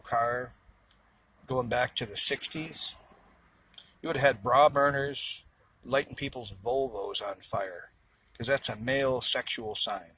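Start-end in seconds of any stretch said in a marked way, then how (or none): none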